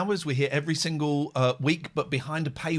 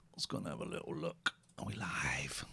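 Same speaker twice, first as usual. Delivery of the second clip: barely audible, breathy whisper; hushed aside; 11 semitones lower, 13 dB quieter than usual. Real, not acted. Host checking whether the stream is live, whispered aside. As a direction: whisper it to yourself.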